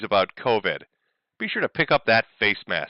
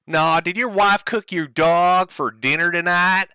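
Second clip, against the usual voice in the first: mock Southern voice; 6 semitones higher, 4 dB louder, slower than usual. Reading Ethan's insult.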